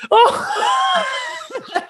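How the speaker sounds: high burst of laughter on "Oh"; real laughing cry of disbelief; laughing shout. Real, not acted. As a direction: explode into a laughing yelp. Watching the hurdler crash.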